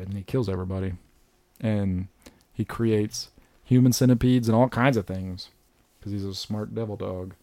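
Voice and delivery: ordinary talk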